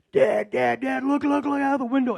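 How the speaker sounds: high, loud urgent calling out; excited son calling. Retold or imitated. Son shouting to father from the car, high and excited. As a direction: grab Dad's attention, pointing out the window. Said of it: "and my son goes".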